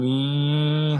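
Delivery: drawn-out level hum of a bed motor. Retold or imitated. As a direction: imitate a motor with one long held note. Imitates motorised bed rising.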